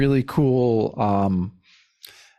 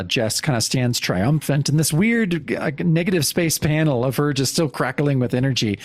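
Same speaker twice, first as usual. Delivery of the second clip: ordinary delivery, nothing marked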